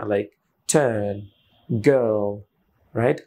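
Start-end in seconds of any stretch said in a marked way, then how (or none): 0.7-2.3 put-on British "turn, girl" with silent r; British style. Shown as a demonstration. Teacher contrasts with American, British accent. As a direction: drop the r, British and drawn out. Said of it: "British হলে এখানে এই রটা উচ্চারণ হতো না".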